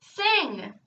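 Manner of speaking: high-pitched, mildly shouted order; shouted command. A narrator's reading. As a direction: call it out to the performer on stage. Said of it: "shouted Double".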